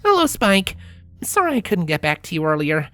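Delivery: lightly voiced Sunset; Sunset's letter voice.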